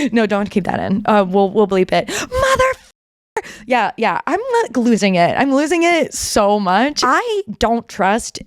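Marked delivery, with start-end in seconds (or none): 2.1-3.4 high cry of Mother!; real burst of giddy disbelief; shrill exclamation. Real, not acted. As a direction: exclaim in a high, startled squeal. Exclaims after screaming.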